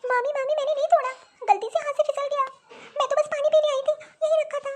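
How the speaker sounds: put-on high young girl begging; a small girl insisting it was an accident; pleading child. Child pleads innocence to aunt. (बच्ची की ऊँची गिड़गिड़ाहट)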